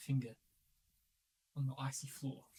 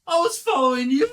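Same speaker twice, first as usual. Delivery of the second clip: raised, high protesting shout; arguing; 11 semitones higher, 17 dB louder than usual. Acted. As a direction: shout it back in a squabble. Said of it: "are having an argument".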